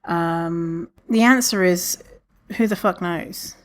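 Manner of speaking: ordinary talk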